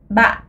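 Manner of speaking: ba falling and cut short in the throat; glottal falling ba. Shown as a demonstration. Teacher models ba with a heavy, glottalised fall. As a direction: drop ba, then stop it hard in the glottis. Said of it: "utilisez votre glotte pour imiter".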